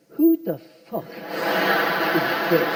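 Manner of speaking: drawn-out, very slow disbelief; mock mouthed words. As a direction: mouth it slowly, word by word. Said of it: "mouthing the words".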